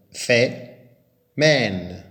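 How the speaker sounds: Fait and Mène punched out loud as separate syllables. Shown as a demonstration. Teacher breaking the word into syllables for pupils, loud. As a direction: hit each syllable on its own. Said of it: "les deux S".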